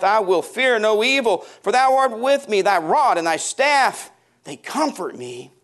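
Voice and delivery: high, loud, rising preacher's cry; impassioned preaching. Acted. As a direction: preach it out with full force. Preaching at full force again.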